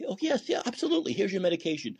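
soft, high, soothing mock reassurance; calm placating doctor. Asylum doctor placating a patient, softly soothing. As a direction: humor the patient, sweetly soft. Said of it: "the doctors in insane asylums, they're very calm".

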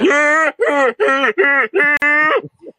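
high, loud honking laugh impression; imitated movie laugh in repeated bursts; Eddie Murphy. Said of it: "That's just his laugh from Beverly Hills Cop".

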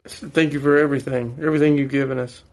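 fervent, fast prayer voice. Acted. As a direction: pray it out earnestly and quickly. Leads a family prayer at home.

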